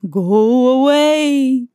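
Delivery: slow, drawn-out spooky moan; slow eerie ghost moan; ghostly moan. A narrator's reading. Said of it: "the ghosts moaned".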